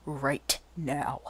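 low furious whisper through the teeth; a slow, breathless whispered warning; angry whisper. Acted. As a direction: hiss the threat, barely voiced. Angry demand after insult to kid.

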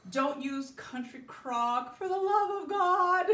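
slow "Don't use country crock", then a sudden loud, high cry on "for the love of God"; exasperated plea. Real, not acted. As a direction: beg them not to use it, building to an outburst. Scolding viewers about margarine.

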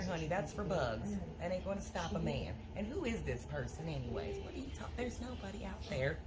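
low-voiced skeptical friend; Helen voice. Second character, Helen.

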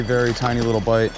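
usual speaking voice

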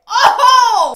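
loud high burst of surprise; startled exclamation; shocked oh. Real, not acted. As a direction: gasp it out loud. Reacting to mom's text.

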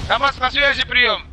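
normal talking voice

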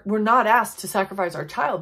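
usual speaking voice

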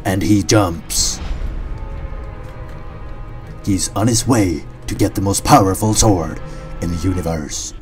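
dramatic trailer narration; solemn movie-trailer voiceover; documentary narrator. Acted.

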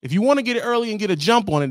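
high, fast dispatcher; high eager dispatcher. Retold or imitated. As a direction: sell the early pickup. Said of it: "They tell you".

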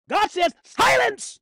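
high, loud order for silence; explosive pulpit command; commanding cry. Acted. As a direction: shout it as a divine command. Voices God commanding silence.

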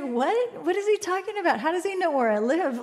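high-pitched, incredulous questions; acted bafflement. Storyteller replaying her reaction to the yelling stranger, baffled. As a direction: retell your bewilderment in a high voice.

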